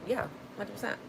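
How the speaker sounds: ordinary talk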